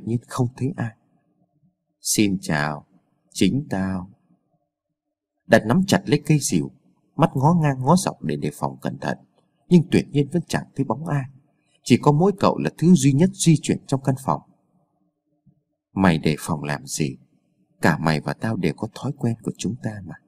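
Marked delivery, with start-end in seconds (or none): 2.0-4.1 low, soft menacing greeting; an unseen double announcing himself, low and hushed; eerie ghostly voice. A narrator's reading. Unseen double greeting Đạt from behind, eerie and low. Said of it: "Cái giọng nói ma quái này". 15.9-20.1 low, soft, unhurried taunt; eerie ghostly voice. A narrator's reading. Hidden double taunting a wary Đạt, eerie and low. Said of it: "Cái giọng nói ma quái này lại vang lên".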